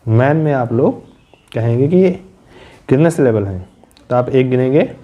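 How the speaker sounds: ordinary delivery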